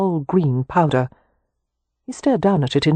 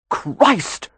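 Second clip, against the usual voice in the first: shocked exclamation; 7 semitones higher than usual. A narrator's reading. Wynne sets the wardrobe alight.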